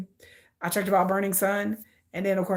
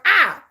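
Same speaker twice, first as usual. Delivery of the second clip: realising ah; 9 semitones higher, 9 dB louder than usual. Real, not acted. Realising he sees anger.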